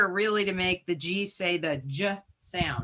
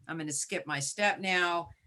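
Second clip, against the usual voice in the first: normal talking voice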